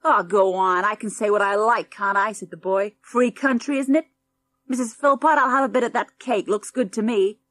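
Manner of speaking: rude brat. Acted. Spoiled boy mocking the farmhouse, sneering. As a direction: sneer at the country house.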